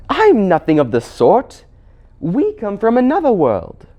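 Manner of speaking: put-on high, loud, indignant girl; Jill. Jill denying the Queen's jibe, indignant.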